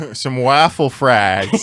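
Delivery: slow drawn-out Yooper vowels; Yooper accent. Pretend. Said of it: "No one sounds like that".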